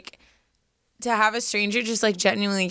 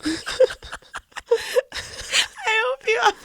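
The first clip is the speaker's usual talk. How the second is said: crying; faster than usual. Real, not acted. Quoting the Trader Joe's clerk while crying, voice breaking. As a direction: voice cracks high into tears, words barely out. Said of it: "I didn't mean to get emotional".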